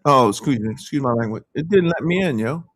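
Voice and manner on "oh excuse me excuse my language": ordinary talk, nothing marked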